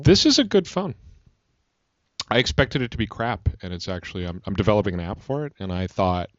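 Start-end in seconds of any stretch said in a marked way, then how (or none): 0.0-1.0 emphatic surprised praise. Real, not acted.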